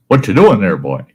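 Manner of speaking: lightly voiced stern superintendent; camp superintendent's deep voice. Superintendent catches the boy at the canoe, mildly gruff. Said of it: "I hear this deep voice".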